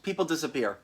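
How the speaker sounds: ordinary delivery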